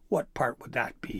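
ordinary delivery, nothing marked

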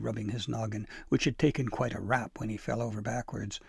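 plain reading voice

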